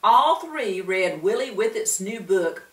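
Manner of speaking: ordinary delivery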